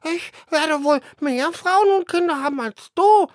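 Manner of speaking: high, loud boastful bird voice; second sparrow. Acted. Second sparrow boasts to his brother, high squeaky voice. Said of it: "sagte der Zweite".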